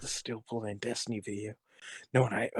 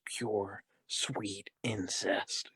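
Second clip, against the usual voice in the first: slow; much slower than usual. Acted. Dramatic reading finale.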